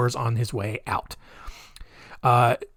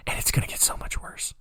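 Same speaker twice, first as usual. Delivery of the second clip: whisper; 11 dB quieter than usual. Real, not acted. Ominous aside.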